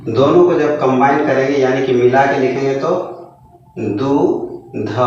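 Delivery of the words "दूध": drawn-out reading. Shown as a demonstration. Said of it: "तब इसको हम लोग क्या पढ़ेंगे?".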